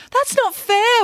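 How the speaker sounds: high wailing protest. A narrator's reading. Mirabella wailing at the art teacher about her sculpture. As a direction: wail it, voice shooting up high. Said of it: "wailed Mirabella".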